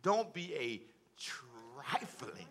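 slow, drawn-out motherly admonishment; mother's admonishing. Retold or imitated. Preacher quoting his mother's warning, slow admonishment. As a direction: imitate a mother's warning, stretching the words. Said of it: "so I heard her say".

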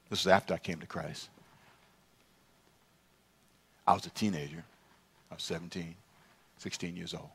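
low, hushed, halting recollection; subdued, grave and quiet; somber. Real, not acted. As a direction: tell it softly, with long heavy pauses. Recalls a friend's suicide.

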